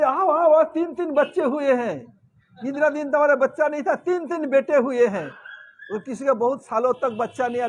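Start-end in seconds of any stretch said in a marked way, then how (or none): 0.0-5.3 excited, higher and louder, rushing the news; joyful calling. Acted. Wife calls her husband in, excitedly announcing three sons. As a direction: call him in, bursting with joy over the sons.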